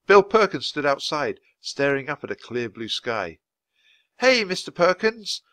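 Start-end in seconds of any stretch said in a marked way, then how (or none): 4.2-5.4 high, eager call across the park; excited shout. A narrator's reading. Annie calling to the weatherman, high excited shout. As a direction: shout the good news to him. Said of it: "shouted Annie".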